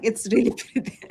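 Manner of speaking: quick words broken by a laugh. Real, not acted. Guilty laugh while admiring the manuscript. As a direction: laugh through it, a little sheepish.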